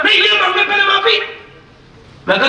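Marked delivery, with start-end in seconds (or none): 0.0-1.2 high-pitched mock squabbling retort; stubborn retort. Second cleric insists the other apologise first, stubbornly. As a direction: fire back a refusal, pitched well up.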